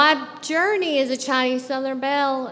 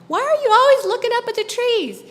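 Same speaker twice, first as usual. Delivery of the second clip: put-on much higher, curious little girl asking; 10 semitones higher, 3 dB louder than usual. Little sister trailing her big brother, asking in a much higher girl's voice. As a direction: pipe it up like a small girl tagging along. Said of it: "My mom, as a little girl".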